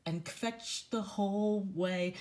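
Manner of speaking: slow, sad sing-song verse; slow storybook reading. A narrator's reading. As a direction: read it slowly, like a sad bedtime rhyme. Reads rhyming book aloud.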